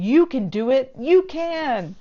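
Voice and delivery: high, sing-song mock pep talk; mocking encouragement. Reviewer mocking Zeth's pep talk, high sing-song. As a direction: parody the hero's cheerleading. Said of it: "without Zeth saying".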